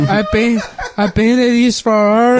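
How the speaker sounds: put-on loud, high wail in a Mexican accent; hammed-up heartbroken blubbering, stretched out; mock crying. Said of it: "then he starts crying on my shoulder".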